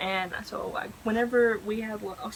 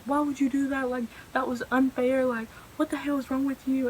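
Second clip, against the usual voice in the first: higher-pitched indignant group scolding; mad at her; 4 semitones higher, 4 dB louder than usual. Retold or imitated. Classmates jumping on girl who spilled the drink, indignant. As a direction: imitate friends piling on in outrage. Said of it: "mad at her".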